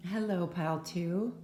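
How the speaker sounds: ordinary talk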